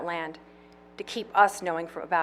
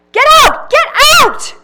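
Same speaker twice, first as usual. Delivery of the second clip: shrill furious scream, very high and loud; shrieked, furious get out; shouting; 18 dB louder than usual. A narrator's reading. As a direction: scream them out of the room. Pauline yells at intruders.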